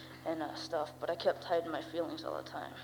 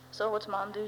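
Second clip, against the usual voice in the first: ordinary talk, nothing marked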